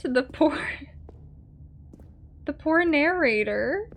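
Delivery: normal talking voice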